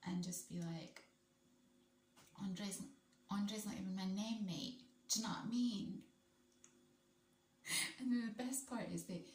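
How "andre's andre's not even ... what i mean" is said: mock-irritated London accent; irritated London rude boy. Telling off anyone who oversteps the mark, irritated London accent. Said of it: "he switches into this London accent".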